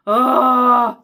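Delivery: loud, high drawn-out groan of delight; swooning "oh". Real, not acted. Reader gushing over stuff like this in a book, swooning. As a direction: belt out a long, overwhelmed "oh".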